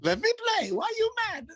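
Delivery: very high-pitched comic mimicry; hammed-up falsetto protest; mock goalie impression. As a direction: echo the quote in a squeaky put-on voice. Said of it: "Remember that, dude?".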